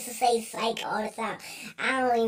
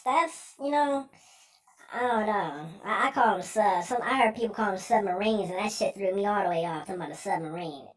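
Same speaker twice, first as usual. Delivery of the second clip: usual speaking voice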